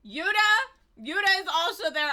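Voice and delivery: high, loud indignant cry; retold outburst. Retelling first playthrough, loud mock-indignant cry. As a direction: shout the name in mock outrage. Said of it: "And I was like".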